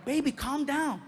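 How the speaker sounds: high-voiced imitation of a worried partner; imitating girlfriend. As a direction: play a girlfriend holding him back. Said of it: "my girlfriend grabbed me".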